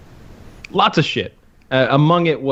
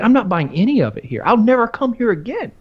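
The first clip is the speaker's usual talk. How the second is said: higher, rising indignant complaint; retold outburst; 10 semitones higher than usual. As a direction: storm off in mock outrage. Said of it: "I was like".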